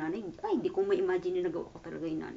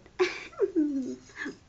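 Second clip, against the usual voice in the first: laugh; 9 semitones higher, 7 dB louder than usual. Real, not acted. Laughing at own teasing.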